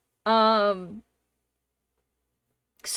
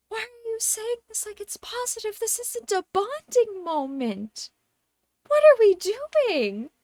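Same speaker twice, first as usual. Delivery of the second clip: incredulous; 8 semitones higher, 4 dB louder than usual. Retold or imitated. Her own incredulous reaction.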